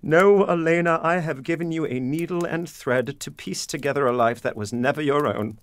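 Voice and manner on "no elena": emphatic no. Acted. Father refusing daughter's comfort.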